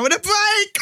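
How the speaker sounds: rising into a drawn-out "break". Acted.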